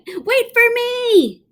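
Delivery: high, slow squeaky cry; squeaky panic. A narrator's reading. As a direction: run after them in a panic. Officer flees too.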